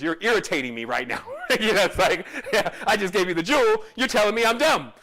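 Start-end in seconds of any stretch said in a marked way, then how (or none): none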